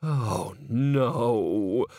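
drawn-out dismayed moan. A narrator's reading. Bucket realizing the chandelier is at risk, moaning. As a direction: moan it in dread. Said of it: "moaned Bucket".

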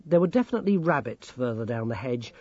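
usual speaking voice

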